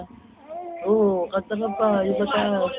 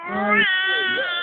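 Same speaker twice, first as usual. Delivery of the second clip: put-on baby talk; 12 semitones higher than usual. Parent coaxing baby.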